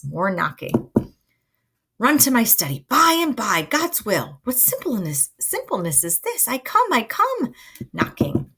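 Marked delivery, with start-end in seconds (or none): 2.0-7.5 jumpy, pitched-up exasperation; flustered Friar. Acted. Friar juggles Romeo and the knocking, flustered. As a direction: swing high as you answer the knocking.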